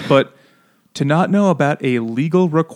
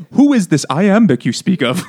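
loud, high, hammed-up bewilderment; mock cluelessness; 5 semitones higher, 5 dB louder than usual. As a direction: ask like a mock-clueless innocent. Mocking her failure to follow.

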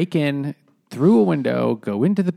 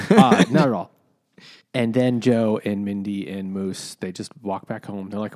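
usual speaking voice

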